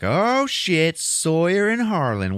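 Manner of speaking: high, drawn-out mock exclamation; gleeful girlfriend exclaiming. Reenie spotting the two together, mock scandalized.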